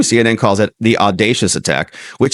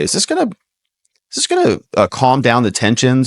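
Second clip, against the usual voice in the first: rising question. Real, not acted. Host mock-wonders about the outcome.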